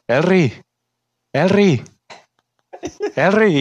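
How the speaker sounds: high, falling name call; mock calling out. Coworker yelling a nickname across the factory, mock calling out. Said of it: "me empieza a gritar".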